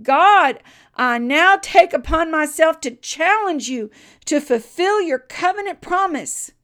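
very loud, high, ringing challenge; crying out to God. Retold or imitated. Blacksmith challenges God to keep promise, loud high cry. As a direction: cry out boldly, voice lifted high. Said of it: "and then he cried".

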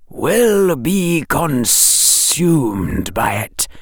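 very slow, hissing snake voice; drawn-out serpent hiss; slow hypnotic serpent hiss. A narrator's reading. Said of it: "The snake hissed".

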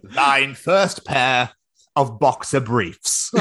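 very slow, grand proclamation; mock ceremonial. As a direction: draw it out like a royal decree. Mock ceremony bestowing first boxers.

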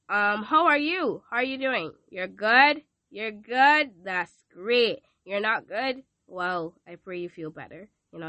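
usual speaking voice

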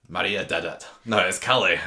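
lightly put-on Scottish accent; Adam. Shown as a demonstration. Attempting Adam for co-host, rough Scottish accent. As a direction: have a go at a soap character's Scottish voice. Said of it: "I can obviously do Adam".

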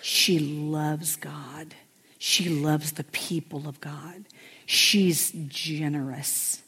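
slow, hushed, breathy-free whisper of praise; slow tender whisper; soft whisper. Real, not acted. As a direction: whisper it like a secret blessing. Tender praise of her friend.

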